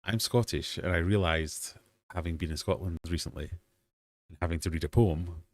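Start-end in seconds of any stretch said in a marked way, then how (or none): none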